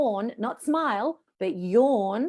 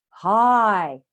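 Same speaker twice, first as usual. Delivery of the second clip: loud, full, sustained "Hi" from the belly; powerful resonant hi; 7 dB louder than usual. Shown as a demonstration. Teacher shows belly support adds power, powerful hi. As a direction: pull the belly in and project it. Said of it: "Do you notice you have more power?".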